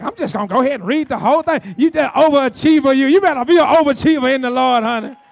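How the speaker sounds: loud, driving pulpit teasing; booming, rolling sermon delivery; fired-up preaching. Real, not acted. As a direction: cheer them on at full preacher volume.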